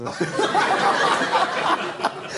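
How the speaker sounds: long airy high laugh; helpless laugh; breathy laugh. Real, not acted. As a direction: let out a big breathless laugh. Laughing at Charlie memory.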